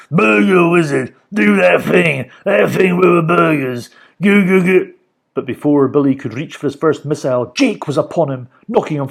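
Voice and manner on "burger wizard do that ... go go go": loud angry villain orders; furious shouting. A narrator's reading. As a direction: bark orders in a rage. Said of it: "shouted Mister Gum in fury".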